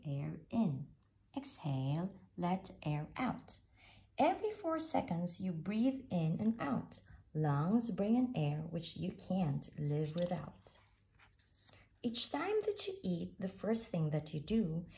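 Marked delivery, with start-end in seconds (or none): none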